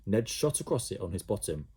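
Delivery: ordinary delivery, nothing marked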